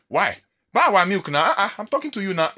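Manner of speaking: high, loud nagging seller imitation; mock shouting seller. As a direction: yell it like a seller chasing a customer. Seller chasing him, demanding attention.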